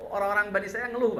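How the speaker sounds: usual speaking voice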